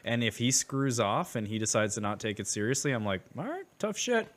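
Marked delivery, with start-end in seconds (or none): 3.4-4.3 mock dismissive. Dad dismissing son.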